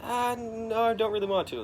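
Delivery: high, whiny refusal; Ben Urich reluctant. Retold or imitated. Reporter dodging his boss, high and pleading. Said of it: "He's like".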